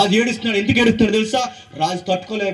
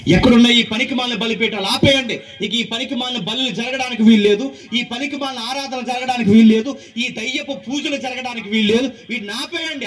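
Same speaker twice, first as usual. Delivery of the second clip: raised, ringing acted command; high-pitched, insistent order to the people; forceful decree; 3 semitones higher than usual. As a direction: proclaim the ban like a king's edict. Same order repeated as a proclamation.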